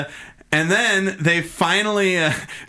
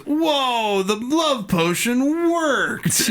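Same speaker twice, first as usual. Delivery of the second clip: higher, slow, drawn-out amazement; dazed amazed Harcourt; 8 semitones higher, much slower than usual. Retold or imitated. Harcourt marvels that the love potion worked, higher and slow. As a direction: stretch it out like he can't believe it worked. Harcourt reacting in retold scene.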